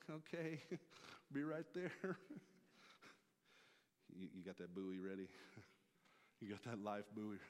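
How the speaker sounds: laughing between nervous words; laughing nervous Peter. Retold or imitated. Peter hesitating at the boat's edge, laughing. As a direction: stall with laughs before stepping out.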